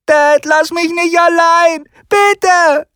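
put-on high child voice, begging; a boy crying after his dad; desperate high-pitched child plea; pleading boy. Child cries after leaving father.

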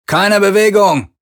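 high, loud, slow bellow; roaring command. A narrator's reading. Boarder roaring at the crew to freeze, full bellow. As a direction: bark the order at the prisoners. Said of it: "brüllte einer von ihnen".

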